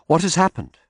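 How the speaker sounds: a sharp question, high and loud; a tense, sharp question to the barrister; sharply. A narrator's reading. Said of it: "more sharply than I should".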